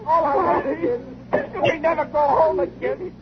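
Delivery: high, crying plea as the way home vanishes; desperate high-pitched dread, near tears; panicked despair. Acted. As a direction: voice cracking with fear over being stranded. Realizes they are stranded forever.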